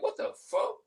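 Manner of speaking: sudden high disbelieving cry; a high startled what the fuck; shocked squeak. Retold or imitated. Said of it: "You be like".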